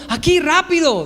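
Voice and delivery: put-on high, hurried kid's call; hammed-up high-pitched holler; imitated urgent yell. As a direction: summon dad as if it's an emergency. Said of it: "porque está gritando".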